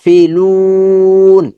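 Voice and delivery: ফি quick, লুন stretched long; long madd. Shown as a demonstration. Teacher demonstrating madd length, slow chant. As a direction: clip ফি, hold লুন in chant. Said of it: "লুনটা লম্বা হবে".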